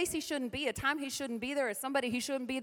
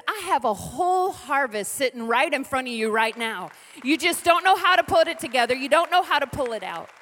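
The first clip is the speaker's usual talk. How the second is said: louder, climbing preacher's cry; full-force preaching; 8 dB louder than usual. Acted. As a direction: preach it hard to the congregation. Voicing God, preaching loudly.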